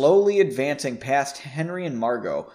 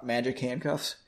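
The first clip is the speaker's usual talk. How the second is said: usual speaking voice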